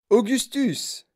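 high cried-out name; shout. A narrator's reading. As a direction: call out sharply in alarm. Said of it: "cria Monsieur Wonka".

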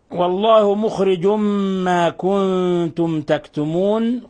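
slow, melodic tajweed recitation; Quran recitation. Shown as a demonstration. Teacher reciting a verse for students, in slow chanted recitation.

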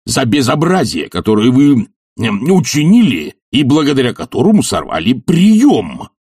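high-pitched, mounting angry tirade; indignant tirade. Acted. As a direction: scold furiously, climbing to an outraged demand. Enraged professor scolds culprit.

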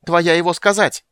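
high, loud creature voice; insistent high-pitched yell; squealed accusation. A narrator's reading. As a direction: throw it out like an indignant little creature. Bupu keeps accusing Tas.

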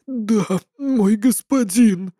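slow obedient servant; bowing underling. Acted. Servant answers.

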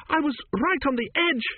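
very high-pitched distressed wail. A narrator's reading. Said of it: "she wailed".

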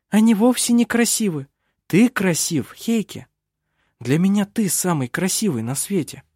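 a hint of a young woman, gently. A narrator's reading. Vinga voiced higher.

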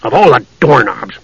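gruff, grumbling scorn; humphing. A narrator's reading. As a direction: huff it out, mildly disgusted. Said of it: "Flint humphed".

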